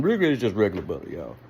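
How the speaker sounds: "Really, it's just regular butter" rattled off very fast and mumbled; rushed throwaway. Shown as a demonstration. Repeating the butter point to viewers, fast and muttered. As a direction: toss it away quickly under the breath. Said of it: "you really don't say".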